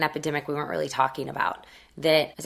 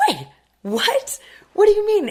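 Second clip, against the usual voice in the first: stunned disbelief; 5 dB louder than usual. Retold or imitated. Teen stunned by the agent's insult, high and loud disbelief. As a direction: voice jumps far higher and louder in shock.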